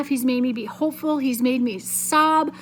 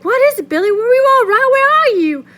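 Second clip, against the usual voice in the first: high, loud, alarmed mother calling; frightened shout; 12 semitones higher, 10 dB louder than usual. Acted. Mother shouting back to Billy, high frightened shout. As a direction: shout back in fear for your son. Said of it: "In a frightened voice, she shouted back".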